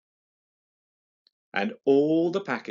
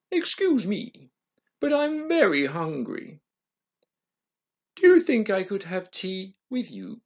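higher, polite tiger voice asking nicely; a courteous hungry tiger, higher than the narrator; a well-mannered tiger voice, pitched higher; 6 semitones higher than usual. Acted. Tiger asks to join tea.

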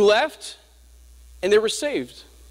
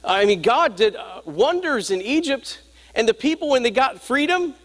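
raised, fervent preacher's delivery; preaching; 9 semitones higher than usual. Acted. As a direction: preach it higher, building to the congregation.

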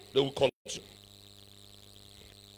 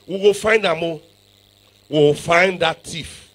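usual speaking voice

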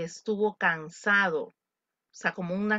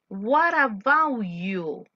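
slow model line; much slower than usual. Shown as a demonstration. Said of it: "model dialogue".

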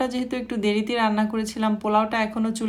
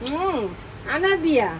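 high, sing-song voice for the little ones; 6 semitones higher, 3 dB quieter than usual. Real, not acted. Talking to baby and child.

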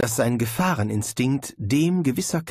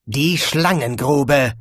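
slow booming command; thundering; 3 semitones higher, 6 dB louder, much slower than usual. A narrator's reading. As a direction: thunder the order. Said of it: "donnerte Abrim".

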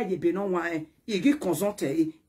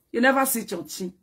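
ordinary talk

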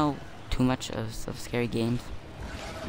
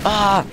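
high loud yelp of pain; pained yell; 9 semitones higher, 8 dB louder than usual. Real, not acted. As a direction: cry out when hit. Hit in fight.

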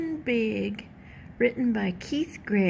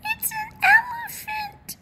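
very high squeaky peep, almost crying; tiny chick voice, high and wailing; peeping smallest chicken; 3 dB louder than usual. A narrator's reading. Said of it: "peeped the smallest chicken".

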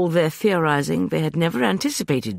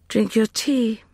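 Justin urging tea; 10 semitones higher, slower than usual. A narrator's reading. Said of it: "said Justin".

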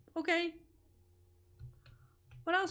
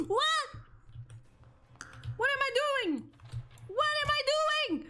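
very high, shrill alarmed questions; panicked disbelief; 13 semitones higher than usual. Real, not acted. Just caught by the monster, squealing in panic. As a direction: shriek in confusion.

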